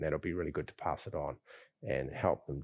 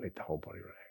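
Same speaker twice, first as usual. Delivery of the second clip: unvoiced, letting the body go; whispered release cue; 9 dB quieter, much faster than usual. Shown as a demonstration. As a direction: softly whisper it on the outbreath. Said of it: "you can do this anytime".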